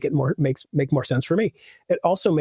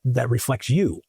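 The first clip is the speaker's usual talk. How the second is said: ordinary talk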